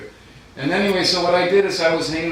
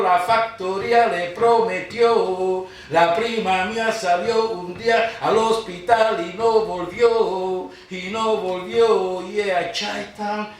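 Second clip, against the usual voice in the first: rhythmic Spanish verse, slowed at the end; poem recital; 7 semitones higher than usual. Acted. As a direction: chant the lament with weight.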